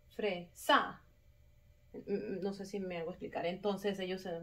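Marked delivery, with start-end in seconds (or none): 0.1-1.0 "fresa" as fre, pause, stronger SA; stress on SA. Shown as a demonstration. Teacher letting pupils hear wrong option, "fresa" stressed on SA. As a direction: punch the last syllable of "fresa". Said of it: "¿Dónde está la sílaba tónica?".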